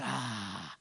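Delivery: soft, low, satisfied; contented sigh. Pretend. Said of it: "steps back and says".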